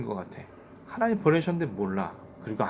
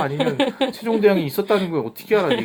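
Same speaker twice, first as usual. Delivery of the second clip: mock challenge; 8 semitones higher, 7 dB louder than usual. Mock-challenging kids about Sejong.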